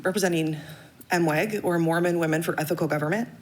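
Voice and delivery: usual speaking voice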